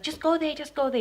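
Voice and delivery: high, insistent order; retold urgent order. Intruder moving her across the lounge, high urgent order.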